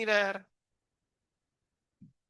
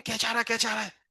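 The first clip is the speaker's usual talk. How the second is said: mock timid murmur; 4 semitones higher, 6 dB quieter, faster than usual. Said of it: "कान में तो जाके बोलोगे नहीं".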